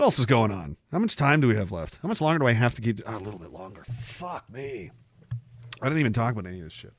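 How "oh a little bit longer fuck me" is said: very soft, low aside; weary muttering. Real, not acted. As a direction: grumble it to yourself under your breath. Weary aside about time left.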